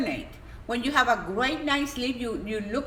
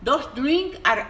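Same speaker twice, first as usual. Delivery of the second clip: ordinary delivery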